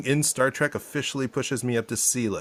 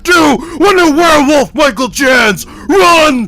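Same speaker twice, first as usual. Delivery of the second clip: high, drawn-out roaring shout; hyped-up shout; 14 dB louder, much slower than usual. Acted. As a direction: belt it out long and loud like a show opener. Hyped-up shouted podcast opening.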